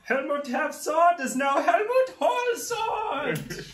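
high, booming posh British sword; proud posh British blade, drawn out; posh British accent. Acted. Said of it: "he's got a posh British accent".

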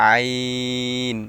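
long, held আইন from mid-throat; slow demonstration of আইন, made mid-throat; mid-throat letter. Shown as a demonstration. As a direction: draw আইন out steadily from the middle of the throat. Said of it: "হলকের মধ্যখান হইতে উচ্চারণ হবে".

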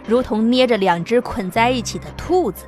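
ordinary delivery

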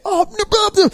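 high, laughing mimicry of kids; hammed-up teen slang; mock kids chatter. Mimicking the kids tossing slurs around, laughing and high.

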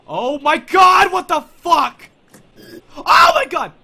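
screaming in real fright; terrified scream. Real, not acted. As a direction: shriek it, truly startled. Jump scare in horror game.

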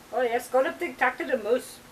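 playful botched Canadian turning Indian Irish; Indian Irish accent. Pretend. Host joking about the moose, mixed-up Indian Irish accent. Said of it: "that's my Indian Irish accent".

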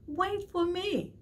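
high, slow plea; little blue fish calling out; pleading call. Acted. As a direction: beg him to wait. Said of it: "he called".

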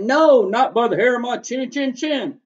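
loud, high defiant refusal; javelina crying out. Acted. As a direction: shout no back at the coyote. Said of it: "The second one cried".

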